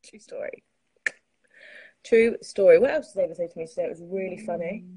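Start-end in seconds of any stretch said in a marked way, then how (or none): 0.0-0.6 soft, voiceless "true story"; whispered aside. Real, not acted. Capping the hot towel story, whispering. Same tag said softly, then aloud. 2.0-2.8 loud, voiced "true story"; emphatic confirmation. Real, not acted. Capping the hot towel story, loudly insisting. Same tag repeated out loud.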